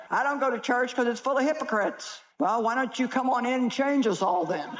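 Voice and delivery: ordinary talk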